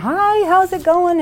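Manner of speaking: bright, high, slowed cheery hello; friendly greeting. Retold or imitated. Greeting the man sitting in front at church, high and cheery. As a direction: sing out a friendly hi to a stranger.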